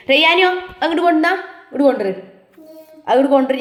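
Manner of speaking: loud, high, fast call across the room; mother shouting to her son to bring it over. Real, not acted. Mother calls to child Rayyan.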